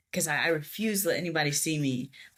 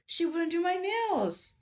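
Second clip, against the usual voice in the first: crying; 13 semitones higher than usual. Retold or imitated. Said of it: "I burst into tears".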